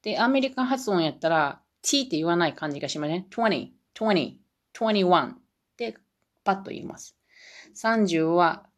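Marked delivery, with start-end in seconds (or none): none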